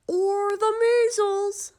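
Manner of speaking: high, loud, slow squeaky doctor; quirky specialist. A narrator's reading. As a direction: pop it out like an odd little doctor. Specialist voiced differently.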